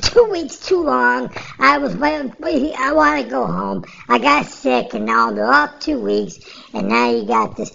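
a hint of Krylov's accent, pleading. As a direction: quote him in Krylov's accent, whining to go home. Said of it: "his accent".

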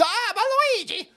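very high, cartoon Italian accent; badly faked Italian accent, squealed. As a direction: wail it in a hammed-up Italian accent. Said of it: "I can't do an Italian accent".

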